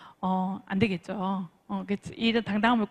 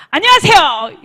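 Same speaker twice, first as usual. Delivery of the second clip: big booming hello, pitched up; confident loud greeting; 6 semitones higher, 13 dB louder than usual. Shown as a demonstration. Coach demonstrating how to greet on arrival, loud and confident. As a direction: belt out the greeting with full confidence. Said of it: "이렇게 해주는 거죠".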